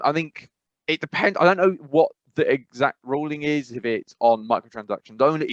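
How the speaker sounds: ordinary talk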